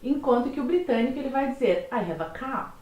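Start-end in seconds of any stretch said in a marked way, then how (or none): 1.9-2.7 British accent, dropping the R in car; British English, final R swallowed. Shown as a demonstration. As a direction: say car as "cah", no R at the end. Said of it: "praticamente comendo o R do final da frase".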